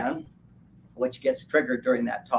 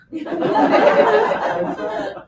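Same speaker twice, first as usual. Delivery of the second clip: big loud laugh up high; 6 dB louder than usual. Real, not acted. Laughing at men doing nothing, loud high laugh. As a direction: crack up loudly.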